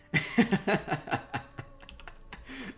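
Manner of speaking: breathy rising giggle; amused airy laugh. Real, not acted. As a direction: laugh at the line you read. Laughs after patron quote.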